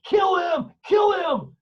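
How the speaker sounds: high, loud mock crowd scream; screaming fans. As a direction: yell it like a bloodthirsty hockey fan. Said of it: "are screaming".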